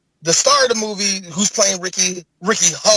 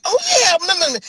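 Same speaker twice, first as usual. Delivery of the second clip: surprised; 11 semitones higher, much faster than usual. Retold or imitated. Retold reaction to seeing him.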